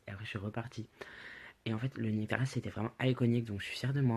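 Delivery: normal talking voice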